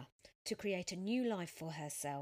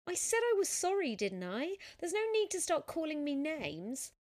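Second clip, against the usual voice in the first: much higher, louder angry retort; indignant anger; 10 semitones higher, 8 dB louder than usual. Acted. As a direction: stand your ground, fed up. Sophia stands up to cyclist.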